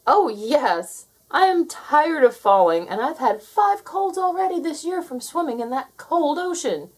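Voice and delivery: Jake pleading, fed up with falling; young Jake. A narrator's reading. Narrator voices Jake.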